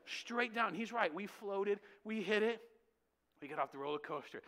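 ordinary talk

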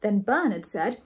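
plain reading voice

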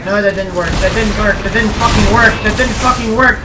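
building frustration. Real, not acted. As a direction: build from grumble to yell.

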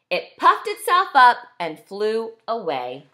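ordinary delivery, nothing marked

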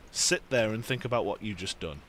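usual speaking voice

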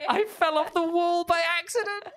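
high, whimpering mock sob; half-cry it in embarrassment. Real, not acted. Embarrassed after falling.

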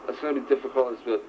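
ordinary talk, nothing marked

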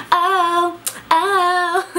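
put-on high valley girl oh, oh.

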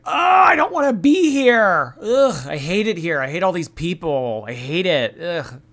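loud, high, whining mockery of Chantal; mocking whiny impression. Commentator mocks Chantal whining in Cuba, loud and whiny. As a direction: shout her complaints like a spoiled brat. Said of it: "she's like".

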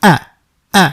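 আ said as a short open a, twice; Arabic alif sound. Shown as a demonstration. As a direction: say আ clearly with an open mouth. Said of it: "এটার উচ্চারণ হবে". (স্পষ্ট করে আ উচ্চারণ)

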